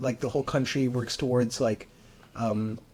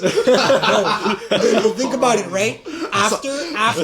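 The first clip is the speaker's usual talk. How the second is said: louder and higher, words bursting through laughter; laughing while talking; 10 semitones higher, 10 dB louder, slower than usual. Real, not acted. Defending a shocking joke about Japan to friends, laughing loudly. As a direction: push the point through your own laughing.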